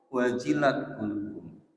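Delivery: usual speaking voice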